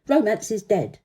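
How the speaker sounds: usual speaking voice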